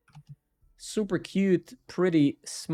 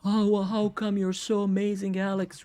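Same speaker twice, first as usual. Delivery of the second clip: lightly mocked neighbor, higher; a mock yell from the neighbor, higher; 6 semitones higher than usual. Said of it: "my neighbor usually yells".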